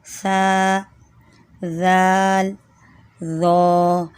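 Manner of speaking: سا زال ذو with tongue between teeth, softly; gentle letter demo. Shown as a demonstration. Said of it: "نرمی سے ادا کرنا ہے".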